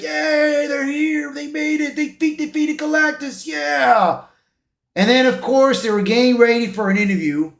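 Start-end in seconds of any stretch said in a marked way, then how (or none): 0.0-4.3 excited crowd cheer. Retold or imitated. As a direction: imitate a crowd celebrating in a high voice. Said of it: "They were all excited, like".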